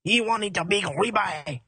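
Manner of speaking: slow, hammed-up French accent; mock French accent, unhurried. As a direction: draw it out in a comic French accent. Plays French tiger's keeper.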